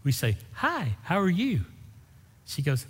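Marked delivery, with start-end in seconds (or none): 0.5-1.6 lightly acted friendly hello, pitched up; cheery greeting. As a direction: greet a passing stranger in a higher voice. Friendly greeting acted in beach story.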